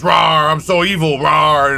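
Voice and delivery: mock-evil villain with roars; hammy bad guy. Player mocking game master's bad guy, hammy and evil. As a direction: send up a corny villain, roaring lightly. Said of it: "Bad guy does his thing".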